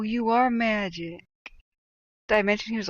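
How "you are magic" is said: slow awe. Retold or imitated. Sleepy friend in awe.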